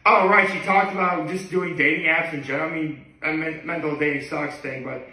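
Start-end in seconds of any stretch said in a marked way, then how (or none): none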